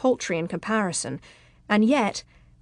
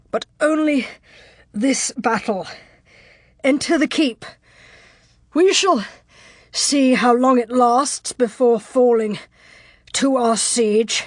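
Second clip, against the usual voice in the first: halting, winded defiance in raised pitch; gasping for breath; 2 semitones higher, 3 dB louder than usual. A narrator's reading. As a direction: push out the threat in broken bursts. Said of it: "breath coming in gasps".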